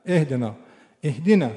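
"اهدنا" with clear Arabic "اه" and long final "نا"; correct "اهدنا". Shown as a demonstration. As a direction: stress "اهدنا" sharply and cleanly.

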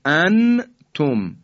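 «انتم» with a clear, short, un-hummed noon; izhar. Shown as a demonstration. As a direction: say «ان» fully and loud, then a separate «تم». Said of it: "این اظهاره".

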